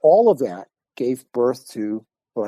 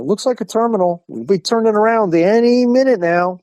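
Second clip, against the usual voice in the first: high, stretched-out comic unease; mock hopefulness; 9 semitones higher, 4 dB louder than usual. Two passengers watch the terminal go by, uneasily hopeful. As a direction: pitch it up as if waiting for the obvious. Acted nervous remark passing the terminal.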